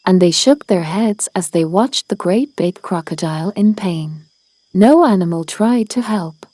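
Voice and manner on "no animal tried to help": ordinary delivery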